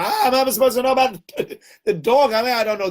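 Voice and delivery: high, laughing protest; a cabbie throwing up his hands, half laughing; exasperated driver. Retold or imitated. Taxi driver protesting he can't know the dog's mother, high and laughing.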